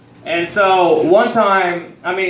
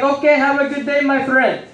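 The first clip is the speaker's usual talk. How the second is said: higher-pitched put-on Indian accent; 6 semitones higher than usual. Store owner seeing a customer out, in an Indian accent. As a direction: sign off in a mock Indian accent. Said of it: "I try to use my accent".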